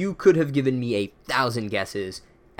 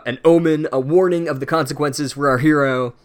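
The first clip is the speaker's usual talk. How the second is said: usual speaking voice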